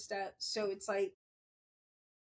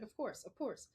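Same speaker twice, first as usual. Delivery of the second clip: softer, light imitation of her son; lightly mimicked son, softly agreeing; Matthew; 5 dB quieter than usual. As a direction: quietly mimic your son's agreement. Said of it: "That's how he talks".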